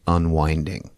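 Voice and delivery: usual speaking voice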